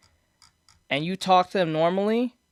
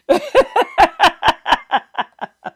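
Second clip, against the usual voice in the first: high, loud burst of laughter; loud real laughter; 12 semitones higher, 8 dB louder than usual. Real, not acted. Cracking up at his own joke to the friend, loud real laughter.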